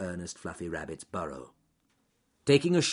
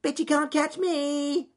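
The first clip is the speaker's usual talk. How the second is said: high, slow sing-out rabbit dare; squeaky taunt; 7 dB louder than usual. Acted. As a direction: tease the wolf in a loud squeak. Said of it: "squeaked Ernest Fluffy Rabbit".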